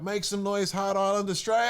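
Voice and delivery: high, loud MC hype shout; yelled hype call. Retold or imitated. MC yelling to the festival crowd, loud hype call. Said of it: "and I yelled out".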